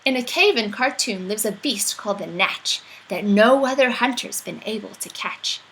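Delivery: usual speaking voice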